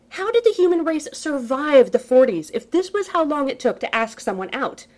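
high, loud, incredulous rant; incredulous exasperation. A narrator's reading. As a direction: rant in disbelief at the slowness. Exasperated rhetorical question.

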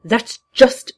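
high, loud, strange cackling tone; Joker voice. Acted. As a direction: bark it out like a madman. Joker laughs, cut off.

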